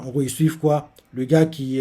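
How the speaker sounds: ordinary talk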